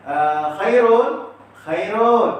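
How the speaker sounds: loud, slow call of Khairul; drawn-out loud call of Khairul; calling out a name. Retold or imitated. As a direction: call the boy by his full name Khairul from across the house.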